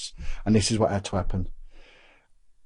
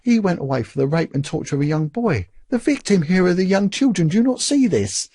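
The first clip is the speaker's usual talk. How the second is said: high-pitched, pleading challenge, re-enacted; retold indignant protest; 9 semitones higher than usual. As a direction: argue back, pitch climbing to the final question. Retold argument with the bishop.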